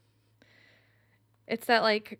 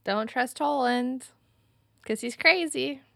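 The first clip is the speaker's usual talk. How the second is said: playful emphasis; 5 semitones higher, 4 dB louder than usual. Real, not acted. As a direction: ham it up for fun. Playful repeat of the quote.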